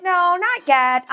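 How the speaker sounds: a lazy brush-off; mocking admin. Admins stalling the teachers, high slow brush-off.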